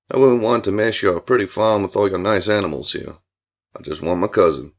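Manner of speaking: low-pitched, folksy country man; easygoing drawling Billy. Acted. Billy greeting Julia about his cousin, folksy low voice. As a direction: lay on the low, courteous charm. Billy's dialogue, story character.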